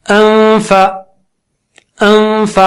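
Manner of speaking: انفا with a long hum through the nose before فا; sustained, even nasal n in انفا; nasal ghunna. Shown as a demonstration. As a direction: lip ready on the teeth, hold the n of انفا nasally. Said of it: "اس دوران ناک سے غنہ کر رہا ہوں".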